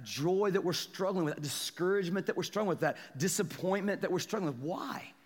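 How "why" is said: ordinary delivery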